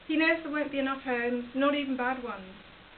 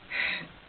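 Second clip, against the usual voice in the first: heavy, voiceless sigh; helpless sigh; weary sigh. Acted. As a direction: let out a sigh, at a loss. Daughter at a loss over mother.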